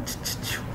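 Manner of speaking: voiceless tss tss, very soft; soft hissing to show the final T; whispered tss. Shown as a demonstration. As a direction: hiss tss like calling a puppy. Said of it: "Igual você tá chamando um cachorrinho bebê".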